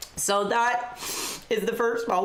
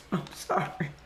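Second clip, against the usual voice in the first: whispered laugh; 4 semitones lower, 6 dB quieter than usual. Real, not acted. Laughing apology mid-story.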